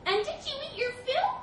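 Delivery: high, loud mouse voice; taunting little mouse. Acted. Mouse questioning the stranded elephant, high-pitched. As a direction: squeak a pointed question.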